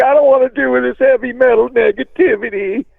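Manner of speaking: high, prim imitation of an offended actress; mock prissy actress. Singer mimicking the upset actress in a high prissy voice. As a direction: sniff it out like a prissy starlet. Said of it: "And she was like".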